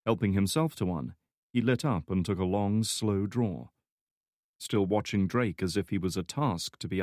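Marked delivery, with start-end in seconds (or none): none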